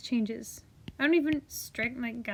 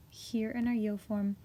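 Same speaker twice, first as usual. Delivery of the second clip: hushed whispered explanation; 2 semitones lower, 3 dB quieter than usual. Real, not acted. Teacher whispers the spelling point to the class.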